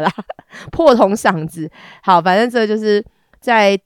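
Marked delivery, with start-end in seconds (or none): none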